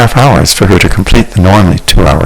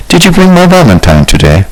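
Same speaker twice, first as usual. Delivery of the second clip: eager question, pitched high; hopeful suitor asking; expectant; 12 semitones higher than usual. A narrator's reading. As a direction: lean in hopefully for the answer. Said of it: "Rogelio asked expectantly".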